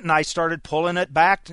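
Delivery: ordinary talk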